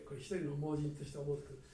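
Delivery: ordinary talk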